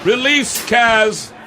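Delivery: slow, high command; commanding judge. Acted. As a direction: give a slow royal order.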